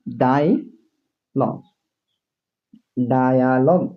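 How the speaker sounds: Dialogue drawn out very slowly, syllable by syllable; slow demo. Shown as a demonstration. Teacher to students, spelling out dialogue very slowly. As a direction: stretch dialogue out slowly so students hear each sound. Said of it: "দেখছো?".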